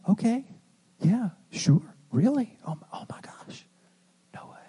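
hushed replies fading into a whisper. Shown as a demonstration. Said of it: "just start getting softer and quieter".

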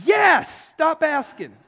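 sudden loud, high exasperated yell. Acted. Fed-up partner answering endless questions, exasperated. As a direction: burst out at a pestering partner.

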